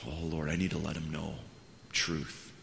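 low, soft, earnest plea; quiet prayer. Retold or imitated. Pastor asks God for help, quietly prayerful. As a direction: drop down as if praying privately.

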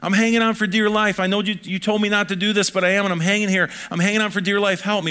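high, loud mock panic of a child stuck up a tree; a child pleading with dad for rescue, hammed-up fear; child hanging on. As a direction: cry out like a kid clinging to a branch. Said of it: "We rescue them".

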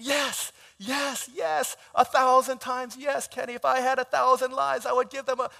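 high, loud gushing acceptance; gushing yes. Retold or imitated. Bride accepting the proposal, gushing high and loud. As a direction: swoon out yes yes yes. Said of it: "that's exactly how she said it".

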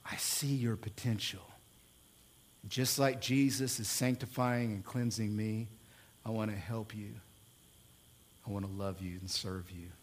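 hushed, low and nearly whispered; quiet sincere reassurance, with pauses; tender promise. Acted. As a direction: drop right down to a soft, intimate vow. Acts out tender spouse's promise.

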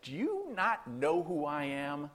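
slow, drawn-out affronted question; Gabriel. Retold or imitated. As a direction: stretch it out like an offended angel. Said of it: "it's almost as though Gabriel's like".

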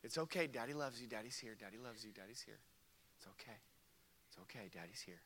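soothing murmur fading almost to nothing; comforting dad. Retold or imitated. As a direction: hush a frightened toddler back to calm. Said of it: "But I comforted her".

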